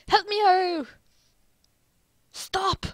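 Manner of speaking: high, loud panicked yelp; panicked cry. Acted. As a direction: cry out for help. Panicked cries in scene.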